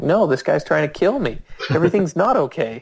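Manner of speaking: high-pitched frantic insistence; panicked retort. Retold or imitated. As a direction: replay refusing to be calmed down.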